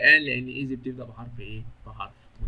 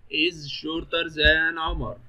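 very slow, stretched example phrase; teacher's slow dictation pace; drawn-out model sentence; 2 semitones higher, much slower than usual. Shown as a demonstration. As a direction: draw each word out for students.

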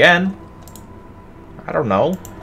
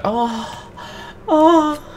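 high, drawn-out imitated moans; mock moan. Player mocking the enemy moaning at them, mock moans. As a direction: imitate a moaning creature, pitched way up. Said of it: "that's why you're moaning at me".

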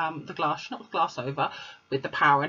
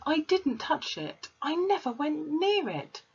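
put-on higher, slow little girl insisting; Matilda; 10 semitones higher, slower than usual. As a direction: insist slowly that you did nothing.

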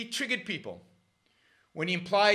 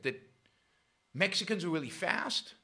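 normal talking voice

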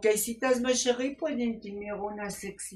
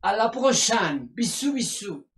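higher, louder, theatrical French sign-off; gushing French farewell; 4 semitones higher, 6 dB louder than usual. Acted. Sexy doctor signing off the show, gushing in mock French. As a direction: blow kisses as you exit.

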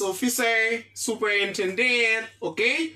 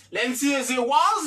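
ordinary talk, nothing marked

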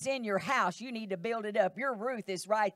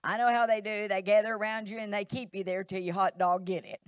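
ordinary talk, nothing marked

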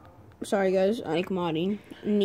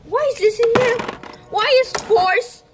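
indignant; 11 semitones higher, 6 dB louder than usual. Real, not acted. Finds wrong parts in box.

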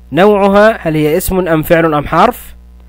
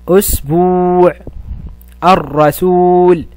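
أسبوع and الرسول with the long u stretched slowly; long vowels drawn out; much slower than usual. Shown as a demonstration. Teacher stretches waw madd words for pupils. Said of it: "كلمات تحوي مدا بالواو".

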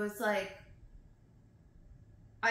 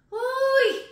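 high, loud "hooey" with a laugh; loud exhaling holler; overwhelmed whoop; 12 dB louder than usual. Real, not acted. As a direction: let out a big high whoop. Overwhelmed reaction to the poem.

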